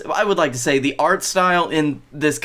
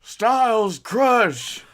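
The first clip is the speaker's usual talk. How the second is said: slow, drawn-out, higher announcer call; wrestling move callout; 7 semitones higher, much slower than usual. Acted. AJ naming his finisher, slow and drawn out. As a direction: stretch out the move name. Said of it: "AJ finishing move name".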